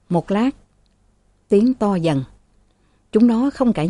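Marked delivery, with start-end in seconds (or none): none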